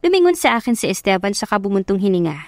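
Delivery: ordinary delivery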